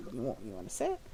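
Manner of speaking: shyly offering a seat; quiet husband. Acted. Reserved husband quietly offering guests a seat. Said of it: "reserved and quiet".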